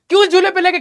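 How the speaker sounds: hammed-up angry wife. Wife hitting him, complaining.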